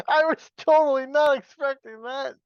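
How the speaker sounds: high, loud, laughing words. Real, not acted. Host caught off guard by a joke answer, laughing. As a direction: say it while really cracking up.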